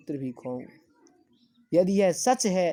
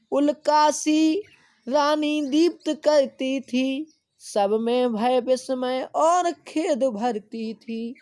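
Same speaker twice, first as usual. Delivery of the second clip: slow, high, loud declaiming; slow lofty verse reading; poem recitation; 9 semitones higher, 5 dB louder, slower than usual. Acted. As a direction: chant the verse slowly to the class.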